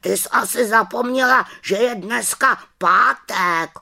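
high, loud reproachful raven; scolding raven. A narrator's reading. Raven warns the witch that today is Friday, high and loud. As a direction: remind her sternly it's Friday.

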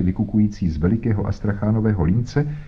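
ordinary delivery

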